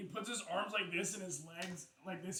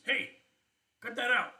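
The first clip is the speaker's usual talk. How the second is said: voice from the mouth; 5 semitones higher, 6 dB louder than usual. Acted. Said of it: "you hear a voice that says".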